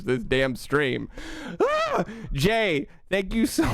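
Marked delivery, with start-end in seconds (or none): 1.6-2.0 high startled yelp; surprised yelp. Real, not acted. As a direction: let out a sudden yelp. Shocked by more gifts.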